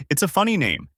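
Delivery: ordinary delivery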